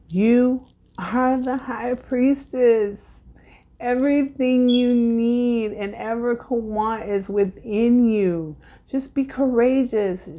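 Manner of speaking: solemn spiritual reading; tarot reading voice. Real, not acted. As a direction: deliver the card's message to the viewer. Reading a tarot card message.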